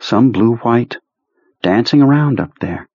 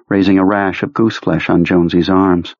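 ordinary delivery, nothing marked

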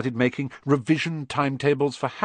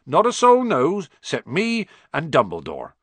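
proud boast, raised and louder on not a soul; 7 semitones higher, 5 dB louder than usual. Acted. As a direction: puff up with pride. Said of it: "said Hagrid proudly".

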